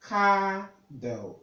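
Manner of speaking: "avocado" broken up, with CA stressed; stress shown. Shown as a demonstration. Tutor breaking the word down for learners, stressed pronunciation. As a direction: split "avocado" into syllables, a-vo-CA-do. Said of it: "So you have a stress here".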